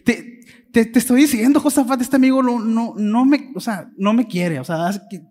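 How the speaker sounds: king whining to Josafat in a loud tantrum. Retold or imitated. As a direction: throw a petulant fit to Josafat. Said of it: "me lo imagino haciendo rabietas".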